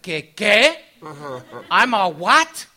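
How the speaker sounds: can't believe the insult; shocked disbelief. Retold or imitated. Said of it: "I was like".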